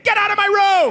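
high, shrill mock yell; hammed-up angry shout at the kid; yelling dad. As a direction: bark it out of the bedroom.

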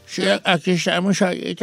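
lightly voiced old man, laughing; a hint of an old [name]. Shown as a demonstration. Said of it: "Habla como [name] de viejito".

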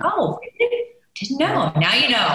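sing-song fake surprise, high on oh really; mock surprise. Telling a friend how she'd brush off doubters, mock surprised. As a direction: play dumb, then land it knowingly. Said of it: "You're like".

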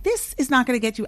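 normal talking voice

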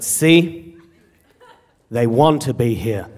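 low, slow stern father; stern dad. Retold or imitated. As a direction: imitate a serious, stern dad. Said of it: "my dad is this very serious".

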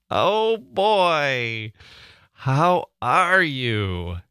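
high, slow delighted greeting. Real, not acted. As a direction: stretch it out with delight. Delighted reaction.

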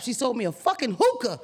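ordinary talk, nothing marked

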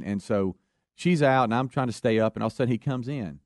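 ordinary delivery

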